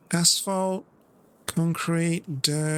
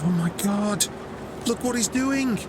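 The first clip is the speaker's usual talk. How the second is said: mock dismay climbing higher in pitch; imitating exasperated friend; 6 semitones higher than usual. Friend watching the driver stray off line, mock dismay. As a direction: play a friend aghast, voice rising on each repeat. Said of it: "Scramble would be like".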